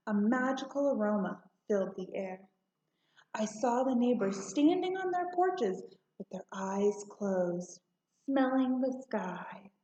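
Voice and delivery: usual speaking voice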